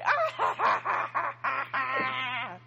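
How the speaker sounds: high cackling mock villain laugh, ha ha ha; hammed-up evil cackle that fades out; evil laughter. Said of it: "like evil laughter".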